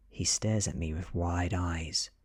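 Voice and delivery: ordinary delivery, nothing marked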